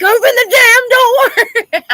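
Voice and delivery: high, loud, slow mock yell at the door; mock shout. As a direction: bang on the door with your voice. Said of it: "He's like".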